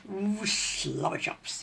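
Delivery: drooling over food; slobbering with hunger. A narrator's reading. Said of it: "drooling over his dinner".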